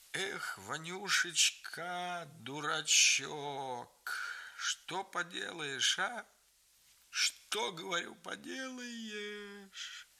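high, slow, creaking lament; creaky, dry sobbing. A narrator's reading. Grandfather grieving to grandmother, creaky and slow. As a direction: drag each word out through dry sobs. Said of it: "сухо всхлипывая, говоря скрипучим голосом".

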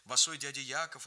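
ordinary delivery, nothing marked

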